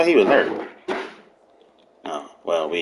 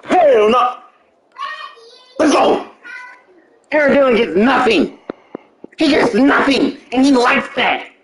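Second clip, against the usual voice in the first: hyped shouting; 12 semitones higher than usual. Real, not acted. Gamer hyping a play on screen.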